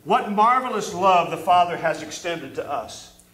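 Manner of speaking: ordinary talk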